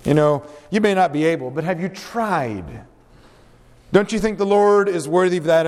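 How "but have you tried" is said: slow and softer, weight on "tried"; emphasis on tried. Shown as a demonstration. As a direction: drop the voice and lean on "tried". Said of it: "And I emphasize the word try".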